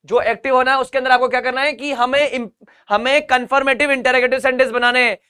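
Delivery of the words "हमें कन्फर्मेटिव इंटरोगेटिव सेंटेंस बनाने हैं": ordinary talk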